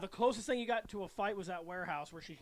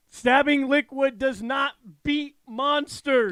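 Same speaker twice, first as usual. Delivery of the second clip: loud, slow scolding yell; 4 semitones higher, 11 dB louder, slower than usual. Retold or imitated. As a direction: shout it at the screen, slowly.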